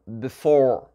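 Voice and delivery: "before" with short "bi" and drawn-out "FORE"; stress on second syllable. Shown as a demonstration. Teacher repeating the model for learners, second-syllable stress. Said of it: "L'accent tonique va être sur la deuxième partie".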